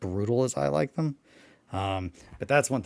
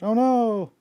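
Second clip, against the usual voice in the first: sudden high, loud "oh no"; startled outcry, pitched way up; dismayed cry; 9 dB louder than usual. Real, not acted. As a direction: yelp of real dismay at the worm. Stepped on a worm.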